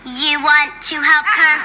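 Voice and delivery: slow, monotone robot echo; robot girl. Acted. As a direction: parrot the words like a machine. Robot girl repeats the same echo.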